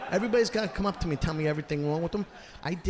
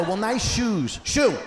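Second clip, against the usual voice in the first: mock retort; 8 semitones higher, 3 dB louder, slower than usual. Comic's retort.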